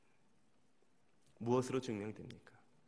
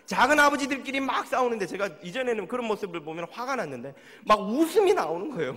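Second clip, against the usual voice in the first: much louder, higher, animated; lively amusement; 8 semitones higher, 12 dB louder than usual. Real, not acted. Pastor describing quarrelling elders, loud delighted telling. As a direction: tell it with rising delight.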